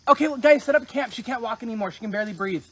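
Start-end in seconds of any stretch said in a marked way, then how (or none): none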